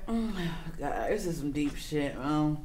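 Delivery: soft, slow, low; quiet dread. Real, not acted. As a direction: mutter it with dread. Dreading a heavy topic.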